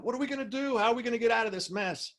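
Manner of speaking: high-pitched mock panic; freaking out. Lost companions in the Alaska wilderness, acting out freaking out. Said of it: "are freaking out".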